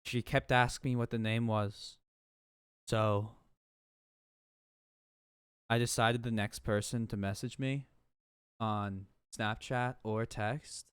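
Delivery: normal talking voice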